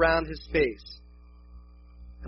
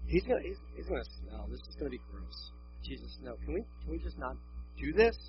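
very soft, low, squeamish protest; imitating a quietly dismayed doubter; hushed reluctance; 5 semitones lower, 11 dB quieter than usual. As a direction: plead under your breath, hesitantly. Voices Martha's quiet dread at tomb.

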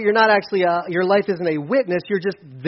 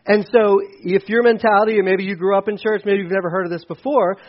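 ordinary delivery

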